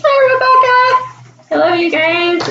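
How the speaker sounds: high, squealed hello; excited greeting. Real, not acted. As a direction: call out happily to a friend. Greets viewer joining the live stream.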